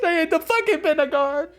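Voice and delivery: very high hammed-up sob; mock wail. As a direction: wail it like a national tragedy. Melodramatic wail about dye ban.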